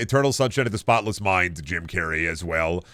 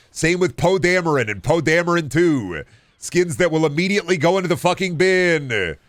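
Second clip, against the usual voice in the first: sarcastic rant; 7 semitones higher than usual. Acted. Sarcastic rant about skins.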